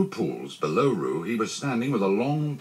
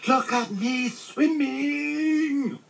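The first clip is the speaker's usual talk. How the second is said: very high, long drawn-out squeal; tiny showing-off voice; squeak; much slower than usual. A narrator's reading. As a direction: squeal it gleefully, stretching every word. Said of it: "squeaked Roo".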